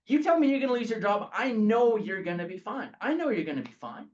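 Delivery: loud, high, worked-up complaint; exasperated rising rant; exasperated outburst. Real, not acted. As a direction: throw up your hands at the unfairness. Exasperated rant about fear.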